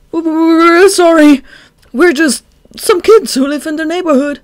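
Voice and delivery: high, loud stammering fake fear; trembling with fear. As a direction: stutter like a scared kid. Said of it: "voice was trembling with fear".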